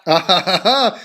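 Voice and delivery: short burst of laughter; quick laugh in reaction. Real, not acted. Laughs at the played clip.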